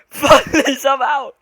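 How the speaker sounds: loud, laughing scared outburst; laughing panic. Real, not acted. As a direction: laugh through the fright.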